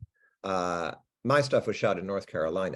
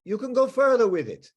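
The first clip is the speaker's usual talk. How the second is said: Frenchman's higher-pitched, animated advice; French accent; 8 semitones higher, 3 dB louder than usual. Retold or imitated. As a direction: put on an energetic French accent. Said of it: "there was a French guy there".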